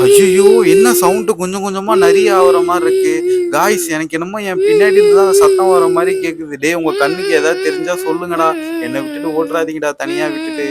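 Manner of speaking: frightened by a sound creeping closer; scared pleading. Acted. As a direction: plead with friends not to leave you alone. Frightened by a growing crying sound. (பயத்தில் கெஞ்சும் குரல்)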